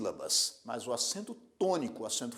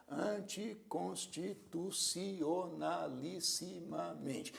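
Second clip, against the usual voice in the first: slow, softer "anticonstitucionalissimamente", each syllable evenly drawn out; 7 dB quieter, much slower than usual. Shown as a demonstration. Teacher sounding out a long word for counting, syllable by syllable. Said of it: "Vamos contar as sílabas dessa palavra".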